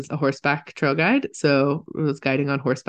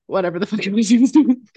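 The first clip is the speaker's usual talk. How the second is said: ordinary talk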